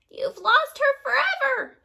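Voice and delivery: very high, loud, slow cackling shriek; witch shriek. A narrator's reading. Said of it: "shrieked the old woman".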